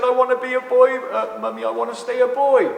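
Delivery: lightly voiced young son to his mother; quoting the son. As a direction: voice the boy asking his mum. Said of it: "she said".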